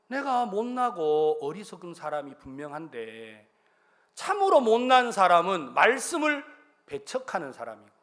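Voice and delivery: ordinary delivery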